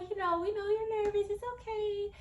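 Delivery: very high, cooing reassurance; reassuring staff voice. Retold or imitated. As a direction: imitate medical staff soothing a nervous patient, very high. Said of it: "They're like".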